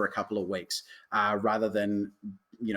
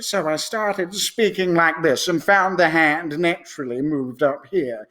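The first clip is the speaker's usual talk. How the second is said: Tim Curry-ish voice; 6 semitones higher, 6 dB louder than usual. Shown as a demonstration. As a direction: purr it out like a theatrical villain. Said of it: "he started off a little bit as Tim Curry".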